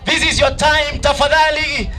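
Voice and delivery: loud, high, rallying MC call; hype MC. Real, not acted. MC rallying the crowd for the second runners-up, loud and high. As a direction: pump the crowd up. MC hyping winners over music.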